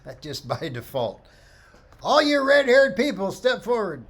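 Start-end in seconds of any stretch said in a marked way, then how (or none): 2.0-4.1 louder and higher, a group summons; playfully calling the redheads out of the crowd; a mock workshop leader shouting to the group.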